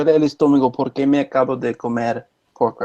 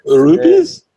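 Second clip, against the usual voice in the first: put-on high, loud Russian-accented rupees; imitating a Russian accent; mock Russian accent; 8 dB louder than usual. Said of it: "Russian accent".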